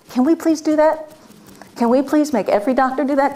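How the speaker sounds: imploring, voice lifted high; heartfelt plea. Real, not acted. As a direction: plead with the whole room. Emotional plea to the audience.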